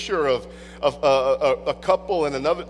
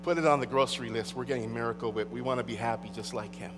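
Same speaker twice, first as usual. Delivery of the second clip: lower, softer, quick aside; hushed half-joking verdict; mock resigned decision; 8 semitones lower, 7 dB quieter, faster than usual. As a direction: mutter it as a settled decision. Spouse deciding to buy.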